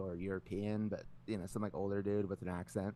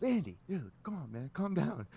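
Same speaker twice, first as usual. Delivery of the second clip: higher-pitched plea to settle down; lightly voiced dealer; 7 semitones higher than usual. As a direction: imitate a dealer calming a player. Dealer calming an angry player.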